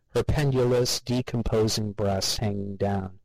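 ordinary delivery, nothing marked

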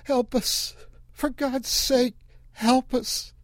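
high, pleading cry; woman in the car pleading. Acted. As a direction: beg for help. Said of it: "It was another voice".

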